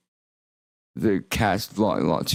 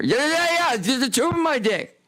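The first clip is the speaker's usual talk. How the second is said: panicked yell. Retold or imitated. Waking from overdose, yelling about the catheter: frantic high yell. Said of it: "the first thing I yell when I wake up".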